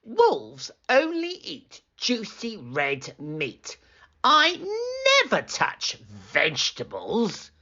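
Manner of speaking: wolf refuses vegetables in disgust. A narrator's reading. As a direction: sneer at the vegetables like a snobby wolf.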